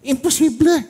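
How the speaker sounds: high, loud "Imposible" flung at the crowd; impassioned cry. Real, not acted. Pastor cries out to the congregation, impassioned. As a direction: cry it out like the peak of a sermon.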